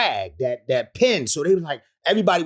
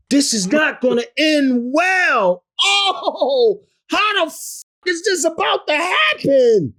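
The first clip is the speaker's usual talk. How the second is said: panicked disbelief; 13 semitones higher, 7 dB louder, slower than usual. Retold or imitated. Panicked inner thoughts before a punch.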